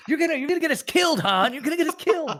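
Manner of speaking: very high, loud, quick whine; hammed-up panicky young hero in a squeal; emo Luke. Mocking Rev as oversensitive Luke warning Han, high-pitched panic. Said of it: "He's become emo Luke".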